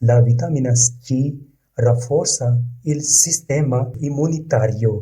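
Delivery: very slow, each word laid out for learners; slow model reading. Shown as a demonstration. Teacher reading the Italian example to learners, slow and careful. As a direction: read it slowly so students can repeat. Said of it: "teacher models Italian sentence".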